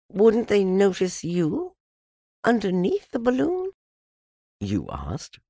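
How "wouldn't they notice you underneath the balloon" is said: put-on high-pitched child's voice, asking Pooh; questioning child. A narrator's reading. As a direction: voice a curious child, pitched well up.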